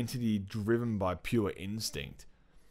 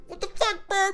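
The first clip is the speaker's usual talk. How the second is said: much higher, startled cry; shocked outburst; 6 dB louder than usual. Retold or imitated. Recalling reading the comic, a high shocked yelp. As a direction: yelp it like the page just shocked you. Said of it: "I was like".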